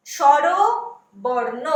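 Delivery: स्वरबर्ण said slowly as "shorobarno", Bengali sh and o sounds; teacher models स्वरबर्ण the Bengali way, slowly; Bengali pronunciation. Shown as a demonstration. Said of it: "Bengali में क्या बोलते हैं".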